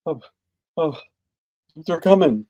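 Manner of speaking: retold frightened gasping words; shaking with fear. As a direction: imitate someone trembling with dread. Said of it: "well, she's shaking".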